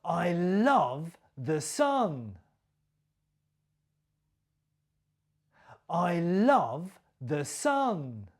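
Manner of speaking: slow, with "sun" stressed and falling. Shown as a demonstration. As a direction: land on "sun" slowly. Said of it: "my stress and my intonation".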